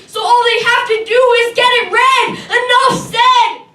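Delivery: rising, ringing poetic outcry; building to a shout. Acted. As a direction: build from forceful to shouting, stretch the last words. Poem builds to its climax.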